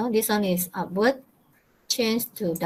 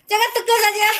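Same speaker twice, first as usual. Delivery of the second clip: laughing; 10 semitones higher, much faster than usual. Real, not acted. Teacher laughs at student answer.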